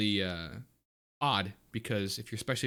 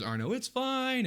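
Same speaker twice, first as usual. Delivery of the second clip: high, slow call at restrained volume; Moo calling out; 13 semitones higher, slower than usual. Acted. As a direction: call from afar without really shouting. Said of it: "Moo calls out to you from the stairs".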